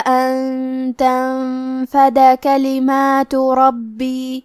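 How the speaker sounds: slow, melodic tajweed recitation; Quran recitation. Shown as a demonstration. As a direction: chant the verse slowly and evenly. Teacher recites Quran verses. (آہستہ، لحن کے ساتھ قرآنی تلاوت)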